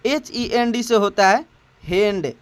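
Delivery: letters spelled slowly then "हैंड" said as English hand; slow spelling. Shown as a demonstration. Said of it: "इसकी उच्चारण क्या होगी?". (धीरे से वर्तनी और "हैंड" बोलना)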